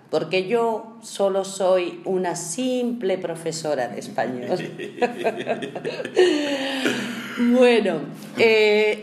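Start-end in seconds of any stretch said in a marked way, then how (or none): 4.6-7.3 laughing burst. Real, not acted. Teacher laughs at her joke. 7.4-9.0 words through held-back laughter; barely holding in a laugh; trying not to laugh. Real, not acted. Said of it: "estamos intentando no reírnos".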